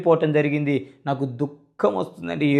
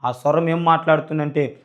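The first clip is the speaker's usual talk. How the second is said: ordinary talk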